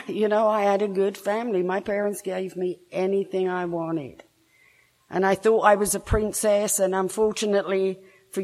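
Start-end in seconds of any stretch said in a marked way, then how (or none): none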